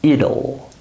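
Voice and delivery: IT'LL pronounced "id-ul"; IT'LL rhyming with "little"; two syllables. Shown as a demonstration. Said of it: "with two syllables".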